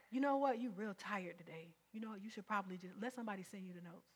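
soft, coaxing whisper of temptation; a hint of a soothing tempter; tempter's voice. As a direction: lull them into staying home. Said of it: "That's the strategy".

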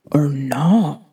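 whispered "Or no?"; whispered aside. Real, not acted. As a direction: whisper it teasingly. Playful aside.

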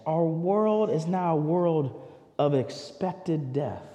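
usual speaking voice

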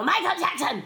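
high, pouncing witch call; playful witch. Retold or imitated. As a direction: jump out at someone as a witch. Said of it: "I'm in full witch makeup".

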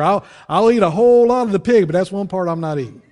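high, loud, emphatic no; emphatic refusal. Retold or imitated. Preacher refusing pig's feet, loud and emphatic. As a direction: draw the line firmly but laughing.

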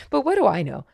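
put-on old man chuckling to himself at his own age, laughing. A narrator's reading. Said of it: "he laughed to himself".

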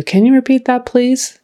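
lightly voiced asking to repeat; listener asking. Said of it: "they might ask you".

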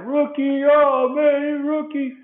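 high, hooting ribbing of the rookie; mock teasing. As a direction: tease him like the whole clubhouse laughing. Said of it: "they just bust up laughing".

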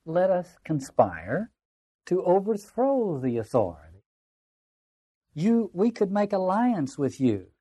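slow, drawn-out invitation to plot; lightly voiced schemer, lingering on words; conspiring tempter. Tempter asking Thomas to conspire against authority, slow lightly acted scheming.

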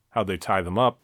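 ordinary delivery

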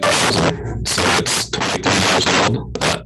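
breaking up, glitchy call audio; glitchy robotic call. Real, not acted. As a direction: voice distorting over a bad connection. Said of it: "Your audio is breaking up".

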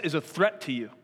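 normal talking voice

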